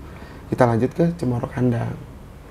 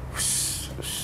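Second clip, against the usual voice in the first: two short whooshes of sudden wind; imitated wind gusts; 15 dB quieter than usual. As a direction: mimic a gust with quick whooshes. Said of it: "tiba-tiba angin kenceng". (menirukan embusan angin kencang)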